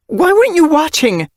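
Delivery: high, loud angry demand. Acted. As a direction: scold the careless sitter. Mother scolds Slate.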